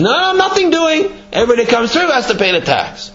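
high-pitched, quick mock refusal; insistent refusal. Donkey guard refuses the king's fox passage, insistent. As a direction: play a stubborn guard, pitched well up.

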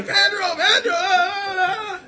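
very high mock crowd cheer; retold high-pitched roar; screaming crowd. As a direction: imitate fans screaming a hero's name. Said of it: "everybody's going".

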